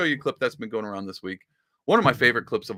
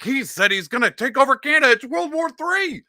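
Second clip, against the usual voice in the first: mock panic; 13 semitones higher, 5 dB louder than usual. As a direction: squeal it like the world is ending. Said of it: "It was like".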